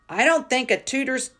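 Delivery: high loud contemptuous yell. A narrator's reading. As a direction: spit the scorn out loud. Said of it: "the Trench Bowl yelled".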